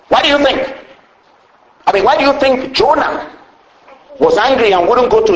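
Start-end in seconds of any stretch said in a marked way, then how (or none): none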